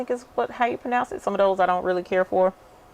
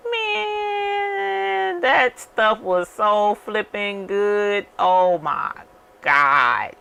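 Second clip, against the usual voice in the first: raving; 4 semitones higher, much slower than usual. Real, not acted. Raving about pickled okra.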